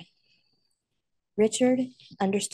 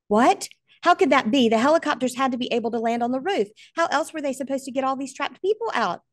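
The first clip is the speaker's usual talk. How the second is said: shocked disbelief; 5 semitones higher, 4 dB louder than usual. Acted. Son disbelieving helicopters can't land.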